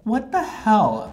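high, slow mock protest; mock outrage. Vlogger jokingly accusing lookalike idol, mock outrage. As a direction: draw it out in playful indignation.

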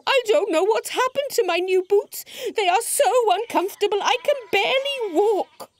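high, whiny story-character complaint; upset little dinosaur voice; distressed crying out. A narrator's reading. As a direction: wail it high, full of woe. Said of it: "cried Dewi".

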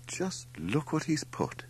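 high, slow indignation; indignant. A narrator's reading. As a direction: exclaim in affronted disbelief. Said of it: "she exclaimed indignantly".

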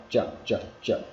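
"chợ" with a J-like ch, little air; pressed-down J sound. Shown as a demonstration. Teacher drilling English J, "chợ" pressed down with little air. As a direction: press the onset of "chợ" down, holding back breath. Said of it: "ta gằn cái giọng xuống một tí".

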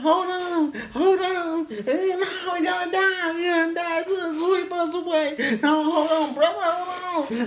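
sustained high falsetto wail; two men wailing in hammed-up panic, very high; mock panicked screaming. Friends clinging on as the storm hits, high mock screaming. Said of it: "and I'm telling him".

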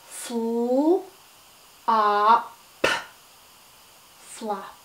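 segment "flop" slowly; stretched sounds. Shown as a demonstration. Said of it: "write the word flop".

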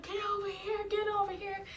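high-pitched mock instructor call; instructor yelling. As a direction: order them over like a frantic instructor. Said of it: "They're going crazy".

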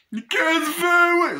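sudden high, loud yelp; mock shout. Real, not acted. Vlogger yells at his own slip, high and loud. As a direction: blurt it out in a squeaky yell.

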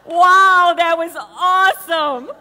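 high, loud, laughing delight; real giddy enthusiasm; gleeful laughing. Real, not acted. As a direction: exclaim it, tickled with yourself. Delighted, laughing after the performance.

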